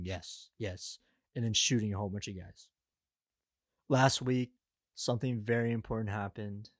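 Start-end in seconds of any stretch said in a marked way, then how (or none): 0.0-1.0 low, quiet deadpan imitation; mock a man of few words, low and muted; Jason Statham. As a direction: drop into a soft, blank monotone. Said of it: "It was just Jason Statham saying".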